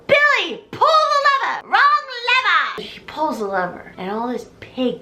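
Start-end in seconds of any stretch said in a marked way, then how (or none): 0.1-2.8 high, loud mock yell; hammed-up panicked shout. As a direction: shout the order. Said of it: "we hear Chris go".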